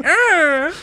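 high hammed-up yelp; a sharp, high, playful ouch; mock cry of pain. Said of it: "Ichigo cries".

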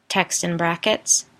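ordinary delivery, nothing marked

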